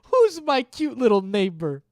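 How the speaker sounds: put-on high, loud cooing baby voice; baby talk. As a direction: coo it like talking to a puppy. Baby talk to pet-like viewer.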